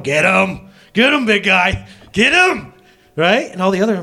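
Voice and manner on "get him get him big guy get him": cheering on. Retold or imitated. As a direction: egg him on like a fan ringside. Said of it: "God was right there going".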